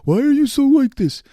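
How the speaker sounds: high mocking quote of other people; a mock baffled friend asking why; imitating everyone. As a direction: toss it up high like a puzzled friend. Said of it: "And everyone's like".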